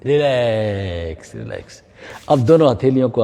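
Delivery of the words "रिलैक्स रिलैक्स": slow, low, fading calm voice; soothing relax. Shown as a demonstration. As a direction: let the word melt away slowly. Yoga teacher easing class. (धीमी, नीची, शांत आवाज़ में)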